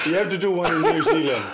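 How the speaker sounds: loud and laughing, pushing hard. Real, not acted. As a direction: insist through laughter. Host laughing, urging the guest.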